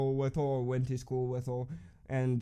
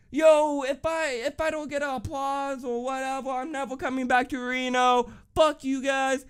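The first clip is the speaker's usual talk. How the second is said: mock angry rapper; 10 semitones higher than usual. Rapper on stage threatening never to return, hammed-up high whine. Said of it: "he's like".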